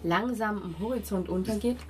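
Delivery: usual speaking voice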